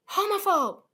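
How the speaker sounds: loud, high mock yell of an insult; imitated yell. Retelling the politician's comeback to a heckler, yelled. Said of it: "turns around and yells".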